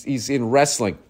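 ordinary talk

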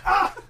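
short, high-pitched cry out; high yelp. Real, not acted. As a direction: let out a sudden high yelp. Reacting to a birthday spanking.

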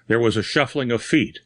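ordinary delivery, nothing marked

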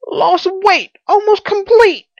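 very high, loud character voice; distressed Pycraft. Acted. Pycraft confessing his weightlessness, high and loud. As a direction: cry it out in high dismay.

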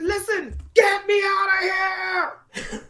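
panicked retold scream, high and crying; screamed plea. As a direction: scream to be taken out of there. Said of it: "I screamed at the person".

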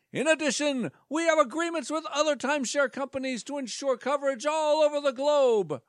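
high, upbeat pitchman voice; salesman voice. Acted. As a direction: pitch it like a cheesy presenter.

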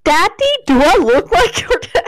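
high, playful mock outrage; mock indignant quote. Co-host quotes a Vine, mock indignant. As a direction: imitate a viral-clip outburst.

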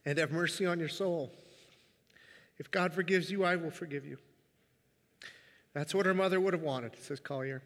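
reading through tears, pausing to compose himself; moved, halting delivery; choked up. Real, not acted. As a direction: fight back tears while reading aloud. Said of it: "I wept, but it's pretty obvious".

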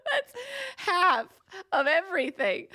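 high, laughing outcry; high laughing protest; laughing exclamation. Real, not acted. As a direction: laugh through the words in disbelief. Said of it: "laughing while exclaiming".

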